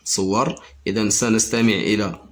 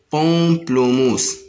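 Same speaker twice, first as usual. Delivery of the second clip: pamplemousse drawn out slowly; slow syllable demo; 3 semitones higher, 3 dB louder, much slower than usual. Shown as a demonstration. Teacher sounding out pamplemousse for pupils. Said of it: "Le mot pamplemousse".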